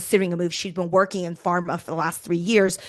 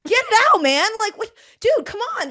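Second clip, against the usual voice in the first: exasperated; 12 semitones higher, 4 dB louder than usual. Retold or imitated. Exasperated call receiver.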